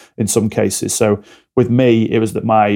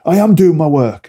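put-on defensive child protest; 6 semitones higher, 4 dB louder than usual. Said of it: "child versus parent clash".

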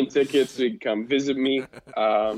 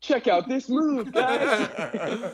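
higher, playful streamer hype; mock streamer voice; 8 semitones higher, much slower than usual. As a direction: show off a move to the chat. Mocking a streamer showing off.

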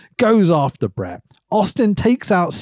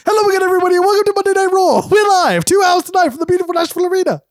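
high, fast announcer voice; imitating J R; 12 semitones higher, 4 dB louder, much faster than usual. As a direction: rattle it off like a hyped wrestling announcer. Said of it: "He talks immensely fast".

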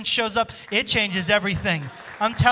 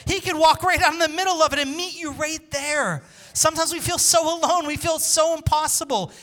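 higher, louder, pressing sermon climax; impassioned preaching; 6 semitones higher, 3 dB louder than usual. Acted. Pastor to congregation, building to an impassioned peak. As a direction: preach it out with rising fervor.

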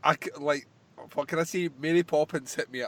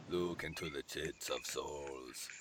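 mock spooky invitation; 11 semitones lower, 16 dB quieter than usual. Mock hypnotic villain line from film.

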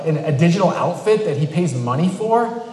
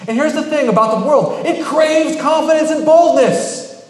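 loud, higher, driving pulpit voice; rousing preaching; 4 semitones higher, 3 dB louder than usual. Acted. Preacher rallying the church, at full force. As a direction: preach it to the congregation with rising fire.

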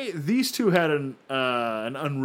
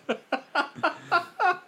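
high laugh. Real, not acted. Laughing after impression.

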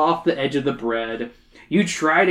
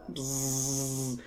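fly buzz; 2 semitones lower, 15 dB quieter than usual. Acted. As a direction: imitate a fly with a long soft buzz. Acting the fly's buzz.

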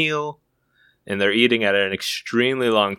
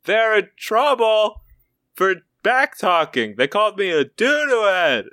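Seinfeld-like yell; 11 semitones higher than usual. Acted. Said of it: "You sound like Jerry Seinfeld now".

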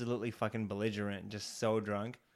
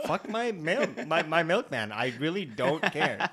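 louder, laughing don't-care voice; laughing shrug; 5 semitones higher, 5 dB louder than usual. Retold or imitated. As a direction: laugh off the insult. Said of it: "He's like".

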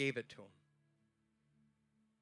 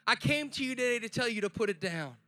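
louder and higher preacher's exhortation; preaching; 5 semitones higher, 4 dB louder than usual. Acted. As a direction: drive each point home to the crowd. Preaching at full force.

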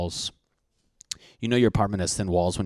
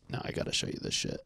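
whispered aside; 11 dB quieter, faster than usual. Real, not acted. Aside while searching online.

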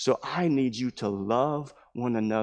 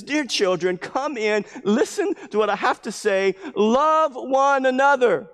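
raised, pleading preacher's appeal; earnest exhortation; 10 semitones higher, 4 dB louder than usual. Acted. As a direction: urge the children in, building higher and louder. Jesus earnestly exhorting his disciples.

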